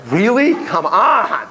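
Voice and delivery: hammed-up incredulity; mock disbelief. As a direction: play up the "oh, come on" reaction. Mock reaction to changed look.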